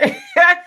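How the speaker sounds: a high, loud laughing burst; sudden real laugh that breaks through; burst of laughter. Real, not acted. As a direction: crack up at your own story. Laughing at own pain story.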